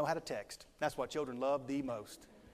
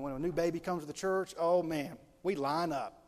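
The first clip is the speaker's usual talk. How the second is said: ordinary delivery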